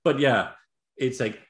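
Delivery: usual speaking voice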